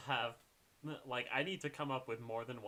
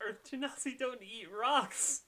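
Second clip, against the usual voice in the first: exasperated; 11 semitones higher, 6 dB louder than usual. Real, not acted. GM correcting a player's claim.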